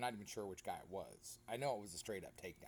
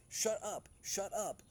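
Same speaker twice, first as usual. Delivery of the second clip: mock pleading shout; 7 semitones higher, 3 dB louder, slower than usual. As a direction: beg them to stop, half-yelling. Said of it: "and I'm like".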